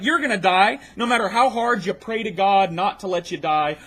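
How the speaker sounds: usual speaking voice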